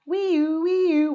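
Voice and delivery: high, loud siren wee-oo; loud siren wail. Acted. Little truck racing out, loud siren. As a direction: blare it like a siren.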